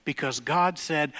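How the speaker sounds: normal talking voice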